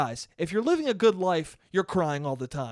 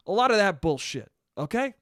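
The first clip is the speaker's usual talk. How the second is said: ordinary talk, nothing marked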